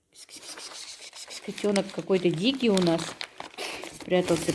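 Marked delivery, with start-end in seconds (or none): 0.1-1.4 unvoiced kss-kss to a kitten; whispered kitty call. Real, not acted. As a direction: hiss softly to coax a kitten. Calling kitten.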